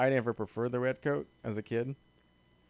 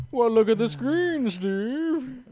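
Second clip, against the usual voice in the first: high, loud, drawn-out call; mock showy reveal; 9 semitones higher, 7 dB louder, much slower than usual. Host directing Steve to the screen, mock showy. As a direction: make a big hammy show of it.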